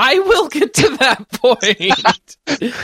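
high, slow laughing insistence; laughing protest. Real, not acted. Friend teased about being slow, laughing protest. Teased he'll never reach it.

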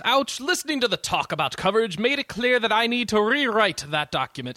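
lightly voiced stuck-up editor; a hint of a pompous boss; read as editor voice. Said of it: "He says".

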